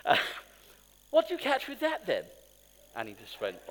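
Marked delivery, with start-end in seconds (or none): 1.1-2.2 imitating a very posh English friend, higher pitched; mock posh English accent, politely asking. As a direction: put on a plummy posh English voice, lifting up. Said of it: "very posh".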